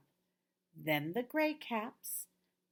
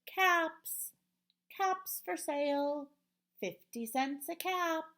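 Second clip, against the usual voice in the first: peddler calling; 8 semitones higher than usual. Acted. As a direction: call out your wares to the town. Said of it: "he walked back to town calling".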